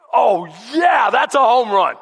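loud, gloating celebration; triumphant cheer. Retold or imitated. Kicker's leg celebrating the blow, triumphant cheer. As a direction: crow loudly over a perfect hit.

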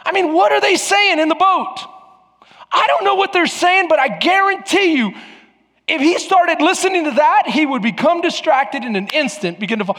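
high, loud full-force preaching. Real, not acted. Preacher pressing the congregation about the boat, impassioned high delivery. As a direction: raise the voice and drive it home.

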